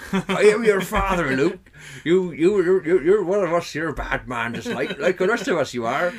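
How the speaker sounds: loud, high West Country farmer impression; hammed-up rural West Country accent; David Prowse. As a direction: boom out mock menace in a West Country burr. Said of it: "that's what he sounded like".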